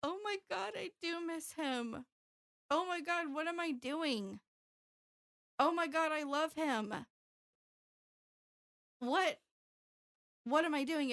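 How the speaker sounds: high, rising panic of realisation; emotional outburst. Retold or imitated. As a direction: relive the shock of still loving him. Retold emotional breakdown, crying scene.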